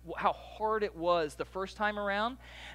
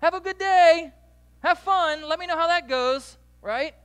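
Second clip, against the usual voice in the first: much higher and louder mock cheerful goodbye; sarcastic send-off; 12 semitones higher, 14 dB louder than usual. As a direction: wave them off with loud fake cheer. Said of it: "You know what I wanna do?".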